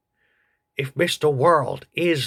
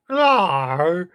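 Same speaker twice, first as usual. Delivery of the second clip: loud creature grunt of Arr; 4 semitones higher, 4 dB louder than usual. Acted. Agnew the creature replies to host with loud Arr. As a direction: Agnew grunts his point. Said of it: "Oh, he's dead?".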